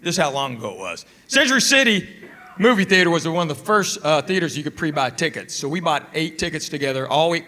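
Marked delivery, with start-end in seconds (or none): none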